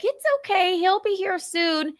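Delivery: cutesy high-pitched soothing; high pet-talk reassurance. Retold or imitated. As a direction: placate a cat in baby talk. Soothing the cat in retelling.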